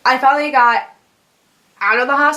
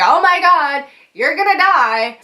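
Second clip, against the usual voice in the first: mock-panicked parents, higher and louder; 5 semitones higher, 3 dB louder than usual. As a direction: voice the parents freaking out, pitched up. Parents freaking out, quoted.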